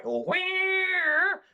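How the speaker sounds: high, drawn-out cat screech; sudden piercing mock cat wail; imitated cat yowl. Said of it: "he was like".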